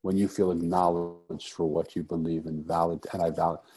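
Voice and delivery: ordinary talk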